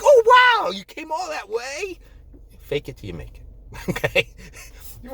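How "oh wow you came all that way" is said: very high, hammed-up wow; mock amazement. Impressed listener hearing of a long journey, mock amazed. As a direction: gush in an exaggerated high voice.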